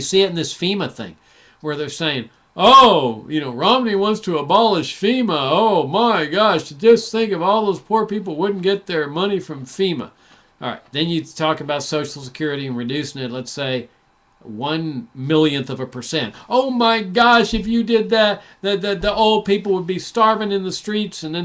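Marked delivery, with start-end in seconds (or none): 2.5-10.1 louder, hammed-up gasping dismay; mock alarm. Mocking critics' alarm over FEMA. 16.5-21.2 mocking alarmists' loud, higher wail about starving old people; mock alarm. Mocking Social Security alarmists.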